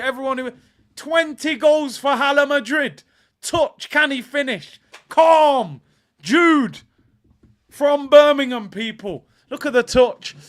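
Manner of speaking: loud, high-pitched football celebration; excited shouted hype. Real, not acted. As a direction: shout his praises, still buzzing from the goal. Still celebrating the equaliser.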